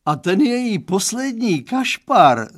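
high, loud wail of disgust; sudden howl. A narrator's reading. Father howling about daughter's last boyfriend. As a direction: howl it out of nowhere. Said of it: "zavil tak z nenadání".

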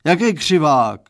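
loud, indignant roar. A narrator's reading. Father roaring at his daughter's correction. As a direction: bellow the question back. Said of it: "zařval pan Stránský".

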